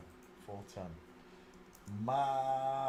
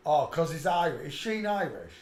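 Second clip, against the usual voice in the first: ordinary delivery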